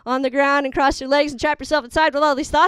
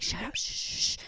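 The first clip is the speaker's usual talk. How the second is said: hushing whisper from the sister; shushing whisper; 13 dB quieter than usual. Retold or imitated. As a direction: hiss at her to be quiet in the cinema. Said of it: "She's like".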